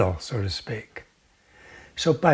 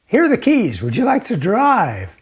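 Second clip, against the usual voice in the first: cheerful offer; 5 semitones higher, 5 dB louder than usual. Acted. She offers him the car keys.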